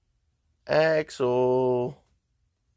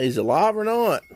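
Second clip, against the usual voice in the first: exasperated; 10 semitones higher, 8 dB louder than usual. Real, not acted. Frustrated at broken stream.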